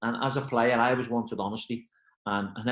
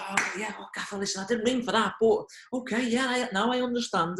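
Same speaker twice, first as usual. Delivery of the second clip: retold relieved gush, pitched much higher; relieved rush; 11 semitones higher than usual. A staffer answering his reassuring gaffer, relieved and eager, much higher. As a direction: act out a staffer's sudden grateful relief.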